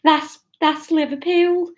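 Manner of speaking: Liverpool accent. Real, not acted. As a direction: slip helplessly into Scouse. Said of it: "Catherine's come out Liverpool".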